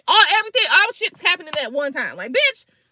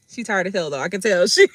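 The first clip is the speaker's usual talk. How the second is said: usual speaking voice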